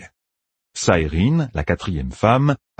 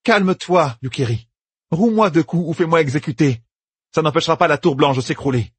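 high, raised, unafraid challenge; defiant Amyrlin; 9 semitones higher than usual. Acted. Egwene defying Yukiri, raised and unafraid. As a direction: dare them to punish her.

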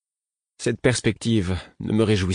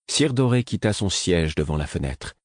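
ordinary delivery

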